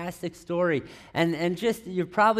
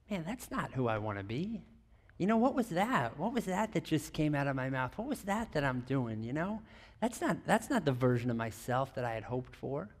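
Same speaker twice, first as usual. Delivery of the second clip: soft, inward questioning; hushed self-reproach; 4 semitones lower, 9 dB quieter than usual. Acted. Someone glimpsing their own behaviour, hushed self-reproach. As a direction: murmur it as a private thought.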